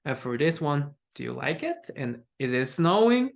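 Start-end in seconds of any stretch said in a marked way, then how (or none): 1.1-1.9 soft question, "it" going up; rising intonation. Shown as a demonstration. Teacher reads the yes-no example, rising intonation. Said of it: "we have Rising Ignition". 2.4-3.3 "snowing" climbs upward; yes-no question lifting on "snowing"; rising intonation. Shown as a demonstration. Teacher models a yes-no question, rising intonation. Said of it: "we have Rising Ignition".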